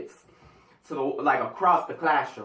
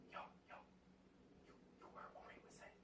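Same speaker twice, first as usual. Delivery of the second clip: hushed whisper to a neighbour; gossipy whisper; 34 dB quieter, much faster than usual. Retold or imitated. Classmates whispering about Ray in a silent classroom, hushed whisper. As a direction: whisper it so the teacher can't hear.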